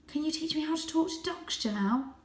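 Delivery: voiced high and light as a young cat; a proud little cat boasting. A narrator's reading. Young Varjak boasting his breed to the stray.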